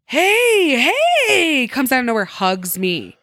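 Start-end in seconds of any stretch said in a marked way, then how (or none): 0.1-1.7 high, loud mock hey hey; excited loud greeting. The stranger bursting up to her at the bar, loud and excited. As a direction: greet her like an old friend, overjoyed.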